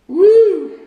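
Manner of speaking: high, loud whoop after a burning sip; whiskey whoop. Real, not acted. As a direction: whoop as the liquor hits. After sipping strong whiskey.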